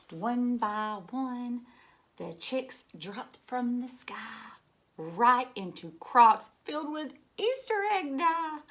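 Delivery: slow, drawn-out rhyming read-aloud; slow storybook reading. A narrator's reading. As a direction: savour each colour for the children. Reads rhyming color lines slowly.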